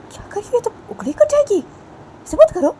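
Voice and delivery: high, loud, crying alarm; high frantic begging; panicked plea. Acted. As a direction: cry out in fear for her finger. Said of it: "तो बानी बोलती है".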